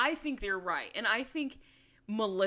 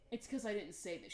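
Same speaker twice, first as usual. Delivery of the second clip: much softer, patronizing and hushed; condescending; 10 dB quieter than usual. Retold or imitated. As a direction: talk down to her softly. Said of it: "a very condescending way".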